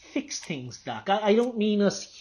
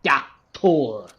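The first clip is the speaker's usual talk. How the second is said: ordinary delivery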